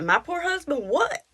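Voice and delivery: incredulous, offended "what?"; indignant disbelief. Retold or imitated. Inner reaction to the stranger's remark, indignant disbelief. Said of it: "in my mind, I'm like".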